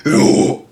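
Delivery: strained breathy ooh; overreaching squeal of a scream; mock failed ooh scream; wrong high scream attempt. Shown as a demonstration.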